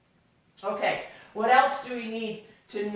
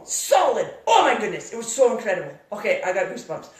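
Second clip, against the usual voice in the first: normal talking voice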